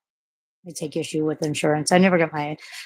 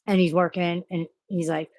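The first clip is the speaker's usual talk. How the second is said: ordinary talk, nothing marked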